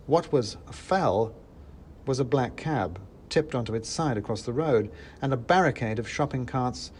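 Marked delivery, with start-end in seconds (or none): none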